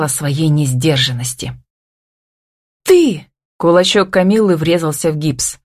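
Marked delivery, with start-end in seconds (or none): 2.8-3.3 high, sharp burst; outraged. A narrator's reading. Woman striking her partner, outraged exclamation. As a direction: burst out at him in anger. Woman punches him in anger.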